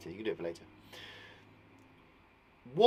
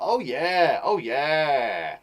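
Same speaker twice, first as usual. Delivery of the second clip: loud, celebratory oh yeah; excited cheer; 7 dB louder, much slower than usual. Real, not acted. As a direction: cheer along with the class. Teacher celebrating with class.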